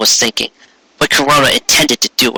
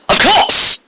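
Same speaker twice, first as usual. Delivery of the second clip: Of course leaping very high; excited realization. Shown as a demonstration. Retaking Trixie's realization, much higher. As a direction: gasp out Of course with sudden discovery. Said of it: "No, let me try that again".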